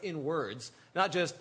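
normal talking voice

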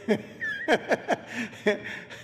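short amused chuckle; real little laugh; light laugh. Real, not acted. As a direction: laugh at your own joke. Laughs after joke.

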